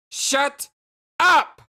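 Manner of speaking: high, loud, word-by-word yell; angry shout. Acted. Jane answering Jake's puzzled huh, furious shout. Repeats order after his "Huh?.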